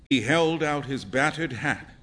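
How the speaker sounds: ordinary delivery, nothing marked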